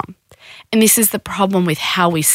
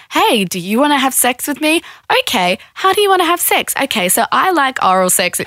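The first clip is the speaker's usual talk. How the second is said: mock consent chat; 7 semitones higher than usual. Models an easy consent conversation.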